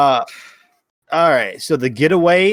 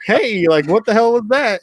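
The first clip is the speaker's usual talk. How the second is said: higher, baffled question; puzzled stranger; 11 semitones higher, 4 dB louder than usual. Retold or imitated. Random contact asking the wife about the strange audio, baffled. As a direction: sound thrown by a weird message. Stranger reacting to odd recording.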